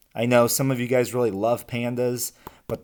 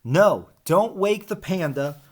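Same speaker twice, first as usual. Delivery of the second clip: urgent warning; 7 semitones higher, 4 dB louder than usual. Acted. Warning grows more urgent.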